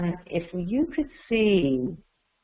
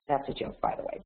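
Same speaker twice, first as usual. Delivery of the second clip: quiet aside; 2 semitones lower, 9 dB quieter, faster than usual. Real, not acted. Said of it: "aside after the joke".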